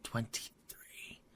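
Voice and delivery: hushed, slow whisper; drawn-out breath of disbelief. Real, not acted. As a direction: whisper it slowly to yourself. Game master repeats the roll.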